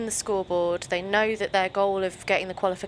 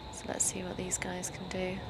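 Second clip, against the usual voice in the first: whispering; 10 dB quieter than usual. Real, not acted. Hushed as team exits plane.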